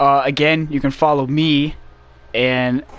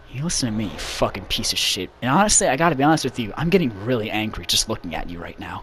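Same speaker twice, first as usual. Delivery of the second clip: low, quiet menace; Sonic; 5 semitones lower, 6 dB quieter than usual. Acted. Sonic squaring up to a fan, low quiet threat. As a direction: hold the anger down, low and soft. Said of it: "gets in the guy's face".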